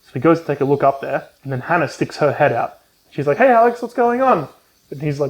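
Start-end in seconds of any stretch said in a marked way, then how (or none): 3.4-4.5 lightly voiced girl. Hannah voiced in retelling.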